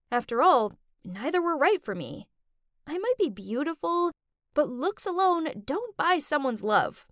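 plain reading voice

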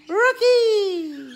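loud high call with a laugh. Real, not acted. Owner calls her parrot Rocky, loud, high and laughing. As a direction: shout the bird's name to get him talking.

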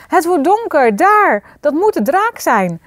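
high, loud alarmed shout; father's urgent cry of alarm; calling out. A narrator's reading. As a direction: shout a warning of danger overhead. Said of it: "riep haar vader".

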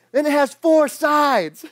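put-on high, loud little-kid voice; mock kid bragging about his toy; proud kid. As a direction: show off like an eager eight-year-old. Kid explains his spinner.

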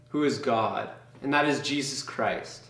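usual speaking voice

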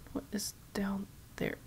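hushed, scared whisper. Real, not acted. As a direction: breathe it out nervously. Scared in a horror game.

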